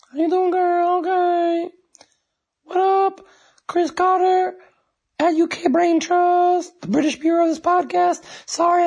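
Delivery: high squeaky put-on character voice; way up high, nasal-free cartoonish drawl; really annoying voice. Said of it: "Why am I doing that really annoying voice?".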